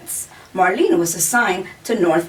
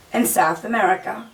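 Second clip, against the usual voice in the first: ordinary delivery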